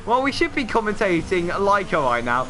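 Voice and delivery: ordinary talk, nothing marked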